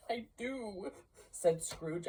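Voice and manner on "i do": higher, softer frightened yes; timid answer. Acted. Scrooge admitting belief to the ghost, timid and higher. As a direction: answer small, cowed.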